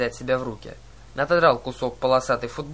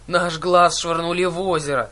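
louder, mildly grieving complaint; crushed lament; 3 semitones higher, 5 dB louder than usual. A narrator's reading. Old sister mourning the lost eye, mildly crushed. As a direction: lament it with a touch of despair. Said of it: "сокрушённо добавила Ужас".

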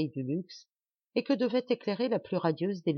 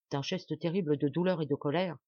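plain reading voice

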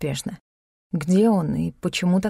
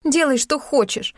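higher-pitched, drawn-out irritated outburst; barked retort; 6 semitones higher than usual. A narrator's reading. Heroine brushes off Dok on the veranda, barking. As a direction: bark it, fed up with him. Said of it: "рявкнула я".

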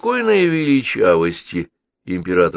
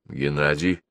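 usual speaking voice

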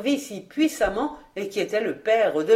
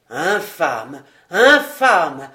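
stammering outrage; 4 semitones lower, 6 dB louder, much slower than usual. A narrator's reading. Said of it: "balbutia Georges".

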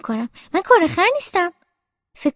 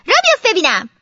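mother's stern, higher-pitched order; raised-voice impatient command; cross command; 4 semitones higher, 5 dB louder than usual. Acted. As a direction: order a child to get moving. Mother drags child along, ordering.

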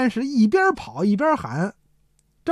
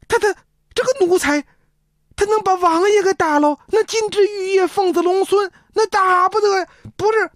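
faked alarm; 11 semitones higher than usual. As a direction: overplay the shock like a hammed-up actor. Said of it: "吓得差点一屁股坐地下似的". (夸张地假装惊吓)

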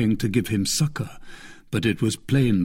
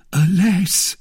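high, loud lament; terrified; 9 semitones higher, 5 dB louder than usual. A narrator's reading. Fourreau confessing after the poisoning, terrified. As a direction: lament in fright. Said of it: "whose teeth chattered with terror".

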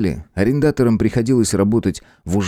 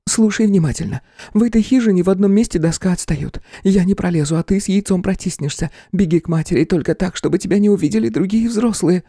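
higher woman's voice, firm; urgent young woman; 11 semitones higher than usual. A narrator's reading. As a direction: instruct him fast and firm. Nuy instructs boy urgently.

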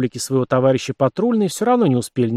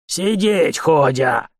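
harsh barking commands; 3 semitones higher, much slower than usual. A narrator's reading. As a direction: bark it at frightened prisoners. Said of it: "рявкнул на нервно дёрнувшихся". (рявкнул, как унтер)

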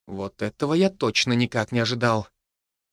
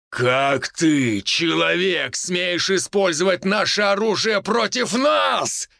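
roaring distorted voice, slow and higher; eldritch horror; 4 semitones higher, slower than usual. A narrator's reading. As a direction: roar it like an otherworldly thing. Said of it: "Взревел этот голос".

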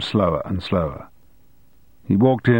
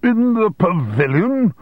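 slow, high-pitched incredulous headmaster; scandalised headmaster's splutter, slowed right down; spluttering disbelief; 13 semitones higher, 3 dB louder, slower than usual. A narrator's reading. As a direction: draw it out high in sheer disbelief. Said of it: "spluttered the headmaster in disbelief".

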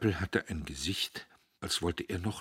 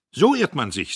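cold, loud and higher; resolute; 8 semitones higher, 14 dB louder than usual. Acted. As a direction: cut him off, determined. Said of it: "zu allem entschlossen". (entschlossen und laut)

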